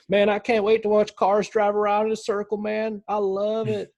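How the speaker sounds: mock Southern hillbilly. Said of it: "with my Southern voice".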